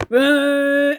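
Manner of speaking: loud, high held "ahh"; imitated ride noise. Teller imitating the noisy ride drowning out yells, loud drone. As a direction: mimic the roar of a starting ride. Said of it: "it was like".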